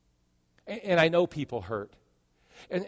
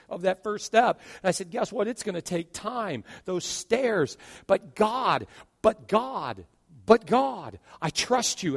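high, loud preacher's climb; full-force preaching; 6 semitones higher, 9 dB louder than usual. Acted. Pastor exhorting the congregation on trust, fervent. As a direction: build it to a cry.

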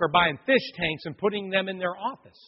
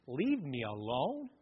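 soft, slow, questioning repeat; incredulous echo; 2 semitones lower, 8 dB quieter, slower than usual. Real, not acted. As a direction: turn the demon's words into a quiet wondering question. Preacher echoes the demon's words.

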